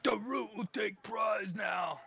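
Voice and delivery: high, slow ogre shout; strained, drawn-out creature holler; demanding ogre. Acted. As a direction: claim the prize as a simple-minded ogre. Ogre demands his prize.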